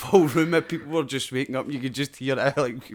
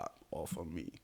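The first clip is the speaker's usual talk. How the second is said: lower, much softer mumble; hushed mock reply; 8 semitones lower, 17 dB quieter than usual. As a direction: mumble it back half-asleep. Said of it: "You're like".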